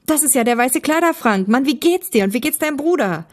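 high, lively happy greeting; delighted. A narrator's reading. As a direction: greet an old friend with delight. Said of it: "rief Thomas erfreut aus".

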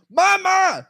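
loud high drawn-out yell of her name; calling out. Retold or imitated. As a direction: call her name with full force. Calling out to mother again.

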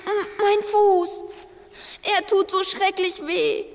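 langsam, gepresst vor Schmerz; vor Schmerz stöhnend. Acted. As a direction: den Schmerz im Fuß hörbar machen. (stöhnend vor Schmerz)

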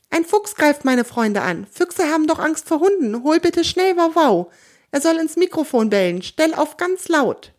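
urgent plea. A narrator's reading. Theo urgently asks for help.

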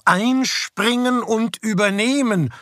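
high, slow, overjoyed repetition; elated. A narrator's reading. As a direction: savour each word like a gift. Joyful echo of the words.

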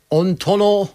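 every vowel turned into O, slow; mock Bavarian Aiwanger O-vowels; Aiwanger imitation. Shown as a demonstration. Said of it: "jeden Vokal einfach nur durch ein O ersetzen".

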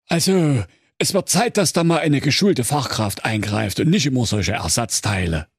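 high, self-assured expert prince; know-it-all medic voice. Acted. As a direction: lecture like a smug professional. (besserwisserischer Sanitäter)

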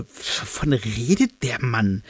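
slow, hushed bafflement; baffled. A narrator's reading. Three pupils watching the adults talk, baffled. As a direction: drag the words out, utterly lost. Three onlookers still totally confused.

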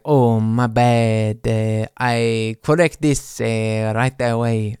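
put-on slow, careful Swedish-accented apology; a lightly played Swede saying sorry; mock Swedish accent. A Swedish friend apologising slowly, mild accent. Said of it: "That's how they sound".

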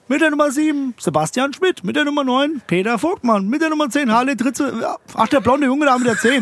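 high, ringing announcer call over the pitch; stadium announcer. Retold or imitated. Coach announcing the youth team lineup, announcer call. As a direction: boom out the lineup like a stadium speaker.

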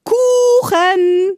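put-on high, loud, demanding baby yell; baby's scream. Giant baby screams for cake from his basket.

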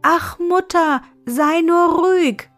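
put-on high, carrying girlish call. A narrator's reading. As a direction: call down to mother from far above. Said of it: "rief sie laut herunter".

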